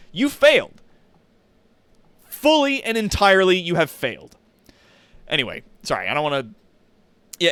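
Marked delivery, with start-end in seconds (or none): 0.1-4.4 much higher and louder, pronouncing judgment; emphatic verdict. Real, not acted. Condemning games that get good too late, loud emphatic verdict. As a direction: slam the game with the verdict. Condemning slow game design.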